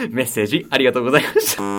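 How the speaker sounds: rushed joke sign-off. Acted. 再びふざけて早口で締める.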